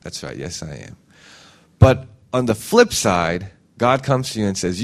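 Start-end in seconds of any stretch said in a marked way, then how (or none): 0.0-1.0 humble agreement. Shown as a demonstration. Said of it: "Say, that".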